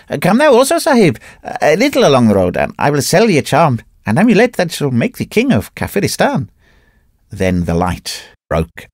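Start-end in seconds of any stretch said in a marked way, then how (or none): 0.1-6.5 crying out. A narrator's reading. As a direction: call back over the shoulder from the camel. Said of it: "turning round to me cried".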